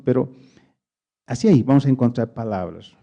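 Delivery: usual speaking voice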